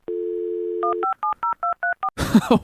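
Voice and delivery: silent breathy laugh, way up high; doubled over, air-only laughter; real high wheezing laugh. Real, not acted. Bursts out at horse joke.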